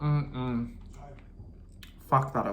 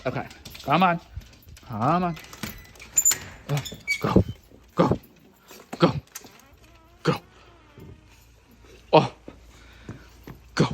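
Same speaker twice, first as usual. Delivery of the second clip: dog voice; 6 semitones higher, 6 dB louder than usual. Real, not acted. Urging the dogs outside, loud high baby talk. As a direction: coax them out like babies. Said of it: "ignore my dog voice".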